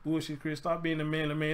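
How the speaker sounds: ordinary delivery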